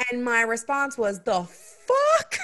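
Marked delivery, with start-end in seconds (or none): 1.2-2.4 high, loud, incredulous outburst; outraged disbelief. Retold or imitated. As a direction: blurt it in shocked disbelief. Outraged reaction to his text.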